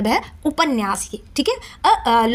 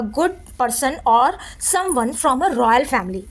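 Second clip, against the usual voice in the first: slow reading; much slower than usual. Shown as a demonstration. Teacher reading a meaning slowly for students. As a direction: read a good person or someone from a royal family very slowly. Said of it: "बोला जा रहा है".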